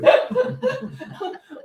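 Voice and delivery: a real laugh, high and free; high bursting laugh. Real, not acted. Woman laughing with her friend, high bursting laugh.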